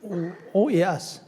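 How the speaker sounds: ordinary talk, nothing marked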